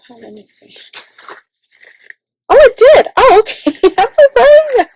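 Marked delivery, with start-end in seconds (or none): 2.5-4.9 high, loud, laughing oops; flustered surprise. Real, not acted. Vlogger realizes her mistake, flustered laughing surprise. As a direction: caught out and laughing at herself.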